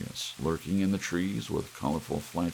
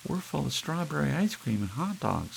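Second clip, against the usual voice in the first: put-on much higher childlike chirp; child voices; 11 semitones higher than usual. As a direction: speak as the kids. Children answer parents.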